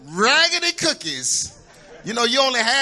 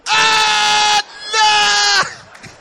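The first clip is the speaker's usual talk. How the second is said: high, loud wail of dismay; shouted horrified no; child's scared cry; 12 semitones higher, 9 dB louder than usual. Retold or imitated. Scared child reacting to taunt.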